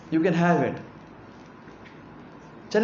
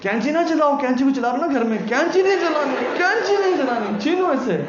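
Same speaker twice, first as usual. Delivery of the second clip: high-pitched mock scolding of a child; superstitious elder scolding; 6 semitones higher than usual. Mimicking a superstitious elder forbidding scissors at home, high mock scold. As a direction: squeak it out like a fussy auntie forbidding scissors.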